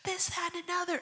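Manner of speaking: high-pitched retold panic; freaking out. Wife phoning husband about being hit, retold freak-out. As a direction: reenact a frantic phone call in a high voice. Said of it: "I'm freaking out".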